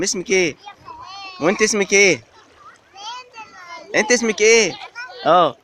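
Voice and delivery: playful parent teasing toddlers in the water. Real, not acted. Parent talks to small kids. (صوت عالٍ مرح للأطفال الصغار)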